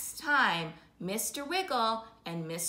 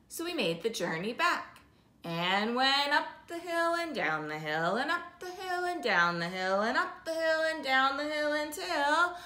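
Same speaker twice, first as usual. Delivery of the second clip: ordinary delivery, nothing marked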